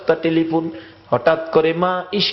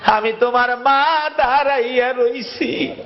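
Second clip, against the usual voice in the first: mother's call; 8 semitones higher than usual. Retold or imitated. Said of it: "একটা চিৎকার মারছে".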